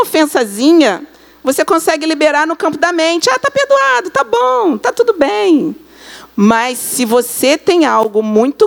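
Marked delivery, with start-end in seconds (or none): 3.3-5.8 high, airy, dismissive little voice; lightly mocking, high and careless; breezy mock forgiveness. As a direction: toss off the pardon as if it cost nothing. Mock casual easy forgiveness.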